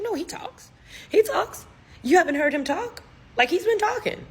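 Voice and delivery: put-on high voice, protesting; high-pitched insistence. Parents insisting their son talks, imitated in a high voice. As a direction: squeak it out as if it were obvious.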